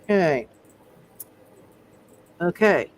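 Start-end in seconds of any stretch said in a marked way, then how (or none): none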